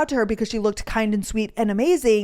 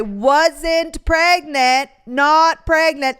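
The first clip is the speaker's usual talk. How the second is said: slow, louder, emphatic not pregnant; relieved excitement; 6 semitones higher, 6 dB louder, much slower than usual. Retold or imitated. Reading the negative test result, slow relieved emphasis. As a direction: stretch it out in relief. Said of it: "jumped with joy".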